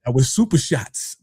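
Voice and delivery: ordinary talk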